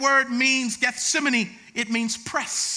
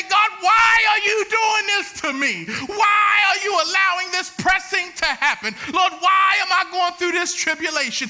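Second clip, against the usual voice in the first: loud, higher mock lament to God; mock anguished prayer, shouted; complaining prayer; 4 semitones higher, 4 dB louder than usual. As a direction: cry out your grievance in a hammed-up wail. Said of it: "I usually say".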